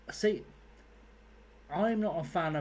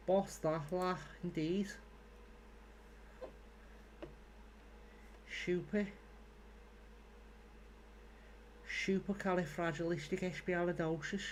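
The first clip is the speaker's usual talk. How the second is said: put-on higher Scouse accent, Liverpool lilt; 5 semitones higher, 6 dB quieter than usual. Gamer showing off his Scouse accent to viewers. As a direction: show off a Scouse accent on long words. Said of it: "I can say big, long, imaginary words in a Scouse accent".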